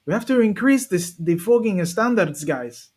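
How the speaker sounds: bad Spanish-accented football manager, stretching the key words; hammed-up Spanish-accented coach, dropping on the last word; terrible Mikel Arteta. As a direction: put on a clumsy Spanish accent and plead with the squad. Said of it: "That's a terrible Mikel Arteta".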